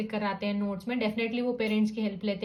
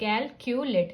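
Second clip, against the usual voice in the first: "calculate" as three distinct beats, cal-cu-late; three syllables; much slower than usual. Shown as a demonstration. Teacher counting three syllables, "calculate" said in separate beats. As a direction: mark each syllable of "calculate". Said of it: "three sounds and three syllables".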